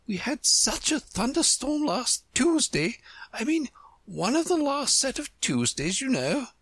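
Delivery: high-pitched, fretful queen. Acted.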